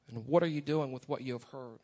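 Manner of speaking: normal talking voice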